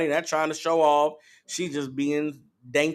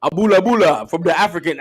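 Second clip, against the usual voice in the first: loud mock African accent; badly faked African accent, louder; 7 dB louder than usual. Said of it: "I'm bad with it".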